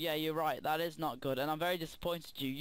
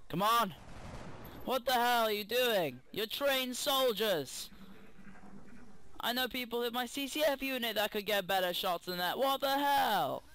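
angry yelling; 6 semitones higher than usual. Acted. Yelling at his game soldiers.

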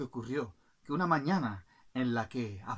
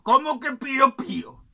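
loud, raised royal outburst; indignant king; 10 semitones higher, 15 dB louder than usual. Acted. King scolds a passing bird, loud and indignant. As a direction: challenge the bird loudly, affronted. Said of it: "Y el rey, viéndolo, dijo".

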